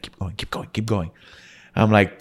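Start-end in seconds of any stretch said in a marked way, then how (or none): 0.0-1.1 hushed urgent whisper. Retold or imitated. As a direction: whisper it so nobody hears. Urging friend quietly, eavesdropper nearby.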